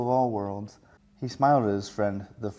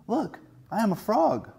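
much higher, proud and a bit louder; triumphant; 11 semitones higher, 4 dB louder than usual. Acted. Tadpole showing off new legs to minnow, triumphant. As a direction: announce it like a big discovery. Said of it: "he said triumphantly".

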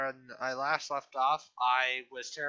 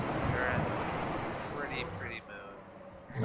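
slow, softer dreamy voice; dreamy awe; 2 semitones lower, 4 dB quieter, much slower than usual. Acted. Gamer asking viewers to admire the moon, slow and dreamy. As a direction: gaze up and savor it.

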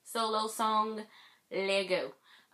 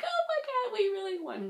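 high squeal of happy disbelief. Retold or imitated. Fan squealing high that her group really won. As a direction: squeak it out like you can't believe they won. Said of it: "And then it's like".

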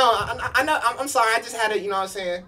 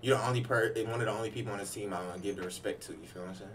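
quiet sincere; 10 semitones lower, 9 dB quieter than usual. Real, not acted. Sincere quiet words to teammate.

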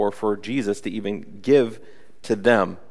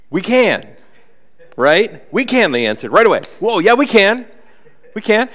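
high, loud, overeager yelling; eager boast; 10 semitones higher, 9 dB louder than usual. Retold or imitated. Disciples boasting to Jesus, high and loud. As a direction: shout it like clueless kids.

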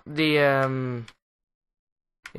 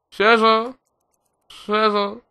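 calling out; 8 semitones higher, 6 dB louder, slower than usual. Real, not acted. Calling for the lost pet.

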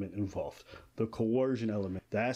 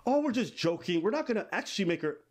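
higher-pitched make-believe excuse; mock defensive excuse; 6 semitones higher than usual. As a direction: lightly act out a defendant brushing it off. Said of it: "They're gonna be like".

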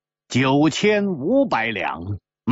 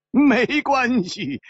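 high, drawn-out reassurance; breezy dismissal; 10 semitones higher, much slower than usual. Acted. Winner waving away the loser's protest, breezily. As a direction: stretch it high and airy.

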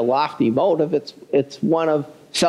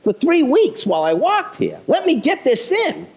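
hurried son; 8 semitones higher than usual. Retold or imitated. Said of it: "The kid's trying to fire off".

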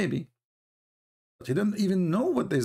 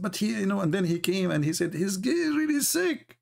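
rising whiny mock plea, much higher by the end; mock complaint; 7 semitones higher than usual. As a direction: climb higher with each word like a panicked relative. Mock brother complaining, rising panic.

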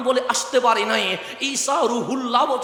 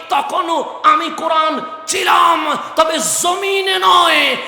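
higher, slow, loud drawn-out cry; slow climactic shout; 6 semitones higher, 7 dB louder, slower than usual. Acted. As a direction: hold each word at the peak. Climax of sermon. (ধীরে উচ্চস্বরে টানা চিৎকার)

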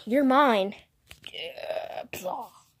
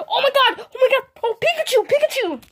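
shrieking laugh into high oh my gods; excited; 11 semitones higher, faster than usual. Real, not acted. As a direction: squeal with delight at the win. Pikachu wins the battle.

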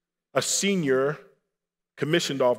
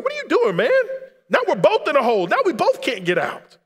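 high, loud, panicked outrage; exasperated shout; 13 semitones higher, 8 dB louder than usual. Retold or imitated. Man in the hole to the soldier who jumped in, exasperated shouting. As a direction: yell in disbelief at a would-be rescuer. Trapped soldier scolds his would-be rescuer.

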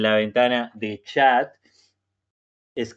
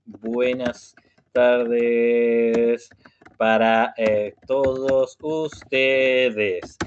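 Buenas tardes stretched out very slowly; slow teacher greeting for beginners; much slower than usual. Shown as a demonstration. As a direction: drag out each syllable for learners. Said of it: "si yo hablo muy despacio".

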